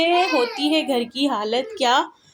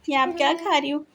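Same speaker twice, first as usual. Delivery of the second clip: ordinary delivery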